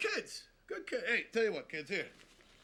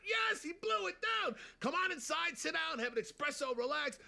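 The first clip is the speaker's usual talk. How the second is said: frantic pig; 10 semitones higher than usual. Acted. Pig shouting in panic.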